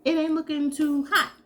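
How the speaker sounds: lightly voiced self-doubt, pitched well up; doubtful inner voice. So I'm like.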